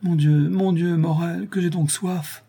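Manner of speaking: high, thin complaint of thirst; plaintive murmur. A narrator's reading. Sick wife begging her husband for water, plaintive. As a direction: moan it weakly, pitched well up. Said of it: "murmura Madeleine".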